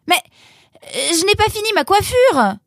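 high-pitched, hesitant objection; protesting. Acted. Roxane with her hair unfinished, protesting. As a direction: plead an excuse loudly.

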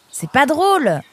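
high, indignant protest. A narrator's reading. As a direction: object sharply, pitch jumping up. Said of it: "protesta Nemrod". (protestation indignée, aiguë)

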